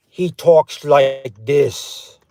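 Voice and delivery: put-on slow Austrian-accented action-star voice; Arnold Schwarzenegger. Quiz host giving players a voice clue, Austrian-accented impression. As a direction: draw it out in a thick Austrian accent. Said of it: "who does that sound like".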